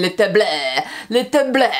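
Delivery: mangled French "Le table", final syllable exaggerated; botched French. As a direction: imitate Lucy butchering "Le table" in French. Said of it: "can't get it right".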